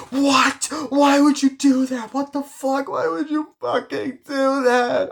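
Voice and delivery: very high, loud acted screech; distraught outrage. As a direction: explode in horrified disbelief, imitating a film meltdown. Acting out a furious, distraught reaction.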